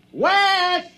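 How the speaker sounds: calling his name, high and loud; high, loud shout of a name. Acted. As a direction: search for him with your voice. Calling out for missing friend.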